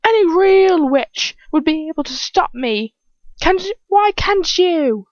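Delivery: high, loud villainous goading; acted scornful challenge, pitched well up; jeering taunt. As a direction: jeer at the victim, stretching the final question. Attacker taunts the choking victim.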